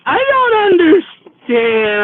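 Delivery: put-on high, slow, tearful drunk whine; whiny drunk. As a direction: wail it like a lost drunk. Drunk woman confused, voiced.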